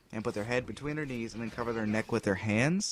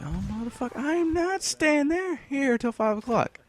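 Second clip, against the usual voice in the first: outraged whine. Retold or imitated. Teen protesting to a classmate about staying late, high whine. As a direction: whine that you refuse to stay.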